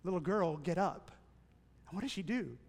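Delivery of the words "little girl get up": soft, lower, tender call; Jesus softly raising the little girl; gentle command. Retold or imitated. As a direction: wake her gently. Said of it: "He says".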